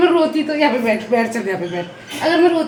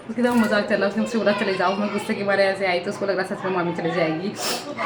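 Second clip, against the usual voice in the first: low, hushed confiding voice; secret aside; 7 semitones lower, 7 dB quieter than usual. Real, not acted. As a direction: keep it down so the prank stays secret. Secret aside explaining the prank. (दबी आवाज़ में राज़ की बात)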